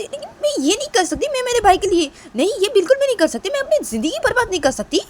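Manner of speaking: very high, loud angry refusal; furious rant. Acted. Kritika fuming that she won't ruin her life. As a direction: shout out the refusal in a rage.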